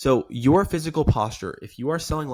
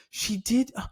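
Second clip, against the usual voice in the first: high-pitched, intrigued questioning; hammed-up wanting to hear more; exaggerated curiosity; 9 semitones higher, 5 dB louder, slower than usual. Shown as a demonstration. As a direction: stretch the words, wide-open and inquisitive. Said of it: "it's a little exaggeratory".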